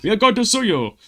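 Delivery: loud, high, hammed-up Japanese accent; a terrible put-on Japanese accent; bad Japanese accent. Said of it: "sorry for that terrible Japanese accent".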